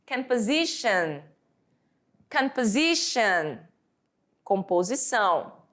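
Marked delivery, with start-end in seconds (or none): 0.0-3.6 Composition spoken slowly in English; slow model word. Shown as a demonstration. Teacher showing how Composition is said, slowly. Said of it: "Como é que nós falamos?".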